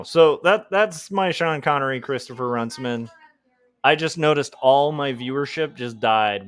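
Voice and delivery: ordinary talk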